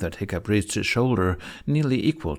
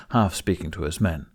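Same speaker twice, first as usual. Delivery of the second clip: usual speaking voice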